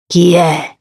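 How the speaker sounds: voce leggermente roca, da uomo; un accenno di raucedine nella domanda. A narrator's reading. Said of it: "Chiese roco". (domanda un po' roca)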